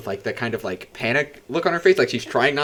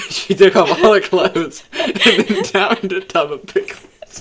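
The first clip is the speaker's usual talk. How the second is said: louder and slower, laughter through the words; laughing; 6 semitones higher, 7 dB louder, slower than usual. Real, not acted. Brother picturing Mary with the pickles, laughing.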